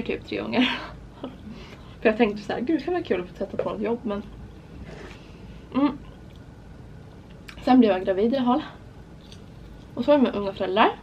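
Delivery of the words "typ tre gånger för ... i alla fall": talking with food in mouth; eating snack while speaking; chewing food. Real, not acted. Seen 1: Food goes in at -1.0; she keeps eating while speaking.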